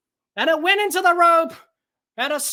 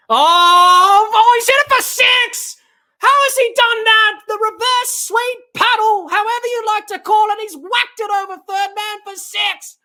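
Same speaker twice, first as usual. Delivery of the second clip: amazed six call; 3 semitones higher, 6 dB louder than usual. Real, not acted. Commentator reacts to a reverse sweep six, amazed shout. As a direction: burst out in disbelief at the shot.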